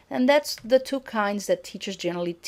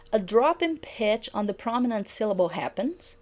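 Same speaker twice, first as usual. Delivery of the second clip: ordinary talk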